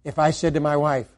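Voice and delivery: normal talking voice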